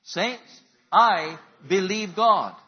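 very loud, slow, high call to the congregation; call and response. Shown as a demonstration. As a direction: lead the crowd, each word stretched. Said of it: "Say it with me".

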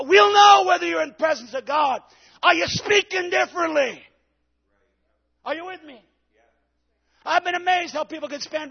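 high, loud, fervent preaching; preaching at full force. Real, not acted. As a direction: shout it to the back pews. Preaching climax.

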